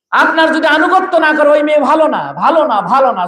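high, driving repetition; forceful preaching. Real, not acted. Preacher repeats the obedience rule to the crowd, high forceful preaching. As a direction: hammer it out high to rouse them.

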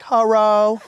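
high, mock Indonesian phone greeting; bad Indonesian accent. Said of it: "forgive me for this bad Indonesian accent".

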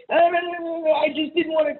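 very high-pitched mimicked excuses; mocking protest. Ex-wife answering the complaint, mockingly imitated in high protest. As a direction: imitate a flustered, squealed comeback.

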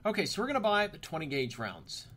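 normal talking voice

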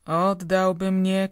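loud indignant retort; shouted. A narrator's reading. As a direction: snap it back, loud and slow. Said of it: "krzyknęła Tomaszowa".

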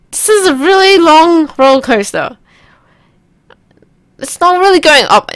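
loud, high-pitched complaint. Pretend. Player keeping the coaster fed with noise, high loud voice. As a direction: keep hollering high while commenting.

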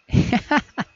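short, high-pitched giggle; high tittering laugh; awkward laugh. Acted. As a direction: giggle after a slip of the tongue. Character laughs after a slip.

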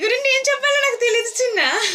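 fighting back tears while thanking him; on the verge of tears. Real, not acted. Thanking a close friend live on stream, voice breaking high. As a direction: voice jumping high and loud, close to crying. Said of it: "నేను ఏడిస్తే".

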